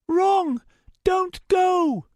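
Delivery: high, loud urgent warning; urgent high girl voice. Acted. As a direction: block him with a desperate cry. Starbreeze blocks the portal.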